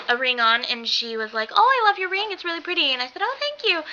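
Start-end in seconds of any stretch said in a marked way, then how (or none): 1.5-3.8 high, girlish-sweet retold gush; imitated polite delight, pitched up; gushing compliment.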